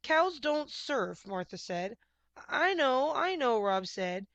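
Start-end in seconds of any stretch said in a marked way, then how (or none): 0.0-1.3 slow, high, deadpan cow voice; stubborn cow. A narrator's reading. Cow Martha scoffing at Rob, slow and dry. 2.5-3.6 loud, high exasperated agreement. A narrator's reading. Rob giving in to his cow, exasperated.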